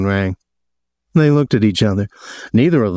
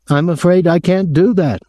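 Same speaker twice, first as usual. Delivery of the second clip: high-pitched, aggrieved hotel official; aggrieved man; 10 semitones higher than usual. A narrator's reading. Hotel man phoning a guest about his visitor, aggrieved. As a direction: put on a high, officious voice of complaint.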